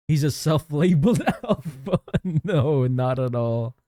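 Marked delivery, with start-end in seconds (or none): none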